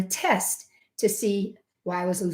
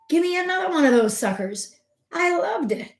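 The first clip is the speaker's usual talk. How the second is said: excited; 9 semitones higher than usual. Retold or imitated. Telling viewers about the vaccine, high excited gushing. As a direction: pitch jumps way up with glee. Joyful recall of feeling young again.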